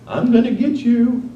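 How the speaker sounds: a playful fake war taunt; mock battle cry. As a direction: toss it off like a playground threat.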